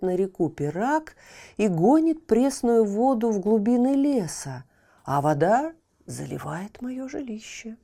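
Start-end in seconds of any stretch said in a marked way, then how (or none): none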